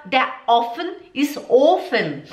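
"Ofen" and "offen" blurred into one vowel, slow; careless learner German. Shown as a demonstration. Teacher demonstrates a student's wrong pronunciation, slowly. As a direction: show the mix-up of "Ofen" and "offen". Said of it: "Você fala".

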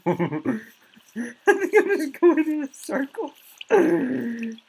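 very high, loud giggly squeak; squeaky silly voice. Pretend. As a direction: squeak it out like a cartoon.

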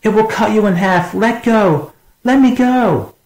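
very high, strained yell; frantic high-pitched shouting, building to the last plea; desperate yelling. A narrator's reading. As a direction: plead at the top of your voice. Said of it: "Stark yelled".

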